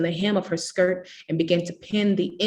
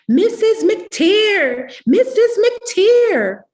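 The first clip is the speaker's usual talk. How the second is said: high, slow, drawn-out yell to a grown-up; tattling child hollering for Mama; 9 dB louder, much slower than usual. A narrator's reading. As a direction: call her name at the top of your voice across the yard. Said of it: "Rosemary hollered".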